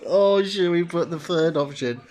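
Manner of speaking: loud words through laughter; laughing. Real, not acted. Tempted to pick the joke answer, laughing hard. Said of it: "I'm laughing my ass off".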